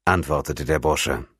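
ordinary delivery

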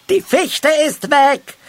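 very high, loud, slow call; calling out from afar. A narrator's reading. Halbschuh repeats the bad news from the tree, calling out. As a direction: holler it down from the treetop. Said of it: "vom Baum rufen".

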